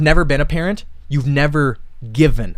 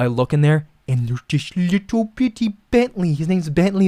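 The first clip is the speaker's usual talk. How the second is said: normal talking voice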